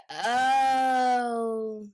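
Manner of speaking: high, loud mock cry of dismay; mock whimper. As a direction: whine it like a fake sob. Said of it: "and I'm like".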